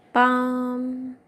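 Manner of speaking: ordinary talk, nothing marked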